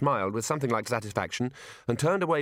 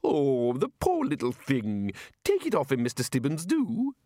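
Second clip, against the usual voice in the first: fussy lady; 3 semitones higher, slower than usual. Acted. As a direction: coo over the poor little thing. Fussy lady fussing over the librarian.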